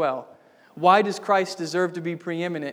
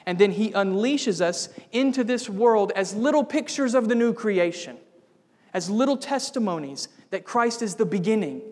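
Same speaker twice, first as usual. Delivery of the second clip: louder, higher, rising preacher's call; preaching at full force; 4 semitones higher, 5 dB louder than usual. Acted. Preacher urging the church toward the new creation, ringing out. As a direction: lift the congregation with fervor.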